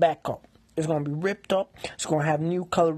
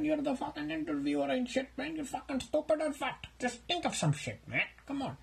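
higher, needling; lightly voiced mocking roommate; 9 semitones higher, 5 dB quieter than usual. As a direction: tease him for being stupid, slightly higher. Roommate insults him.

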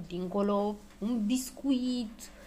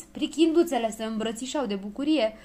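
ordinary delivery, nothing marked

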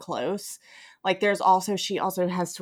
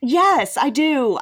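higher and louder, owning it fully; emphatic yes; 7 semitones higher, 7 dB louder, slower than usual. Real, not acted. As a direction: blurt the admission with a burst of energy. Admitting she likes it.

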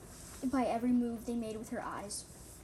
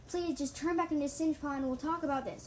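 raised, mildly pleading; begging; 5 semitones higher, 5 dB louder than usual. A narrator's reading. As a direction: appeal urgently to a friend. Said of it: "I begged".

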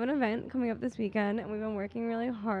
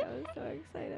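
high, softer giddy squeak; squeaky excited; 7 semitones higher, 8 dB quieter than usual. Acted. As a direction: play up the crack, giddy. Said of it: "My voice just cracked".

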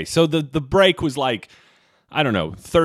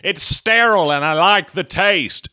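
quoting Rip Thorne; 4 semitones higher than usual. Retold or imitated. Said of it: "what Rip Thorne says from the movie Dodgeball".

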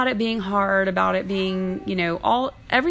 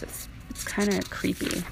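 whisper; 8 dB quieter than usual. Real, not acted. Spooky aside about the book.

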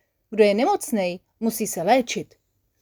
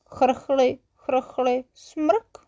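cough and sniffle; 5 semitones higher, much slower than usual. Acted. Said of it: "ozvalo se od dveří".

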